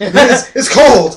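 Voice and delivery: high, loud, drawn-out mock voice; old man's line. Friend retelling the old man standing up, hammed-up old man's line.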